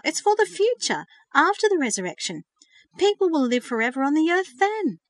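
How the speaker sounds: high, loud rush of discovery; bursting with joy at the idea; excited. A narrator's reading. Verity realizing the truth to her brother, excited. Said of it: "She jumped up and clapped her hands".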